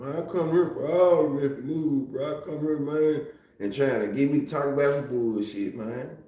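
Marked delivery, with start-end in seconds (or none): 0.0-3.3 put-on mocking quote voice; lightly hammed-up imitation of someone's claim; imitating a viewer. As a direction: mimic him in an altered, singsong voice.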